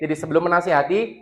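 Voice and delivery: normal talking voice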